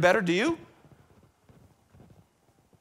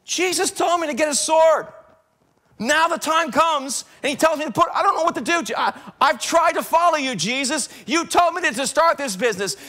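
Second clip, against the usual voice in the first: higher, louder, desperate pleading as Peter; anguished Peter; 6 semitones higher, 5 dB louder than usual. Acted. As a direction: cry out to Jesus, lost and confused. Peter voiced, pleading with Jesus.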